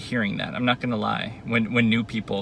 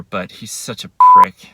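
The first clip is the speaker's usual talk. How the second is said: angry; 12 dB louder than usual. Real, not acted. Venting rage at ex's boyfriend.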